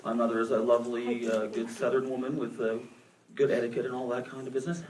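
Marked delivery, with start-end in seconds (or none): none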